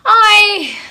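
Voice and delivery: loud high shriek; scream. A narrator's reading. Samosa seller Sadia hit on the head, loud high scream. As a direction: let out a sudden shriek of shock. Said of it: "screamed Sadia".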